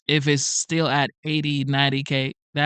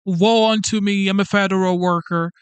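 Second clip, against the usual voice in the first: mock biblical prophet; 3 semitones higher than usual. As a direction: wail like a put-upon martyr. Mocking whining federal worker.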